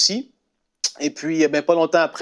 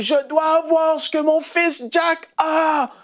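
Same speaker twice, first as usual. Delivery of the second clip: much higher, slow plea; pleading mother; 12 semitones higher, slower than usual. Retold or imitated. Mother at the altar crying out, much higher and slow. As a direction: cry out longing for it. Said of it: "elle crie".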